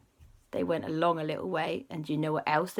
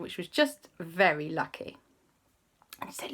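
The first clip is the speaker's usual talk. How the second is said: plain reading voice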